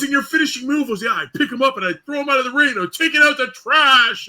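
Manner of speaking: high, loud excited fan voice; drunk fan. Retold or imitated. Drunk fan recounting the finishing move, high and loud. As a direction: gush like a drunk superfan. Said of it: "he goes".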